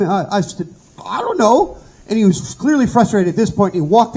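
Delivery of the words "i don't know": trembling reply. Retold or imitated. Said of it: "still trembling and struck dumb".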